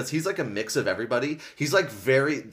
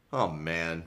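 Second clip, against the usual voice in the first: moaning; 6 semitones lower, 5 dB quieter than usual. A narrator's reading. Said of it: "Marco moaned".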